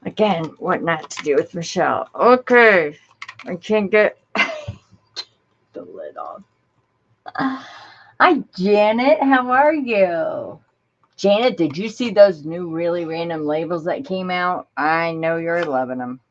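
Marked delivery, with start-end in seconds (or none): 2.1-6.4 loud laughing exasperation. Real, not acted. Laughing at the stuck lid. 7.4-12.4 high, loud sing-song hello; bright greeting. Real, not acted.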